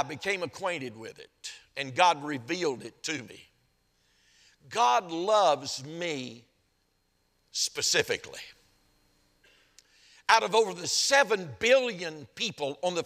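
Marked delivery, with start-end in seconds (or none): 4.7-8.4 very slow, each word given room; slow, weighted statement. Real, not acted. Preacher sharing his own revelation with the congregation, slow and measured. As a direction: let it land slowly, dropping on the last word.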